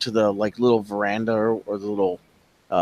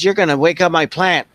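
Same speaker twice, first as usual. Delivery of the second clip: mock yell, higher and louder; imitated yelling; 7 semitones higher, 6 dB louder than usual. Kid mimics the mean neighbor yelling at children, mock yell. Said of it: "She always yells at us".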